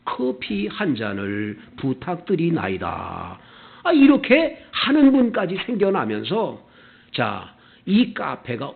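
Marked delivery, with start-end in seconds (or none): 0.0-3.4 low, slow, ceremonious plea; overly deferential courtier. Acted. Customer addresses café owner as royalty, with exaggerated deference. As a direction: draw it out like a subject addressing the king. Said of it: "깍듯이 인사를 하면서".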